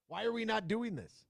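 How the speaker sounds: ordinary talk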